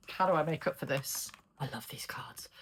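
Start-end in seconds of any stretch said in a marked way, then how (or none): 1.6-2.5 soft unvoiced whisper to herself; whispered aside. Real, not acted. Reader delighting in the cards, whispered aside. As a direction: murmur it under the breath.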